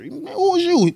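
put-on high-pitched suspicious kid challenging a stranger; wary young self. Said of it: "young me is not gonna listen to stranger danger".

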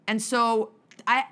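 usual speaking voice

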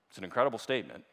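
usual speaking voice

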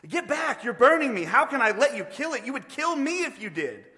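high, loud cry of alarm; panicked ghost. Acted. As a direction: recoil and protest in a high voice. Ghost recoils from burning angel.

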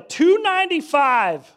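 stunned announcement of the weight; astonished exclamation. Retold or imitated. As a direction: blurt out the shocking number. Reveal of scale reading.

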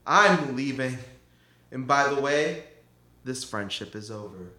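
cold farewell. Acted. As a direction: leave coldly.

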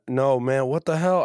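ordinary talk

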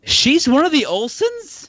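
high, slow disbelieving question; real surprise. Real, not acted. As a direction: blurt it out, stunned by the news. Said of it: "I didn't know that until this exact moment".

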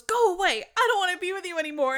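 high, loud retold tantrum; mad. Little boy rejecting his dog, imitated mad shouting. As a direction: shove them away with your voice, imitating a boy. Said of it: "so mad at him".

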